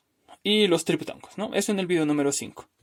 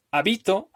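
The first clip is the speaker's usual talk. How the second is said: habito stressed on the middle BI; stress on middle syllable; 4 semitones higher than usual. Shown as a demonstration. Teacher listing a tritonic word, habito stressed on BI. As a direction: hit BI in habito, ha- and -to lighter. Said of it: "Esa es una palabra tritónica".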